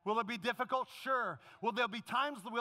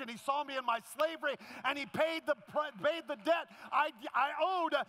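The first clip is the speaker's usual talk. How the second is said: high, loud, building preacher's cry; full-force preaching; 5 semitones higher, 4 dB louder than usual. Acted. Pastor preaching to the congregation about Jesus, full-force preaching. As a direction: preach it out to the back row, louder and louder.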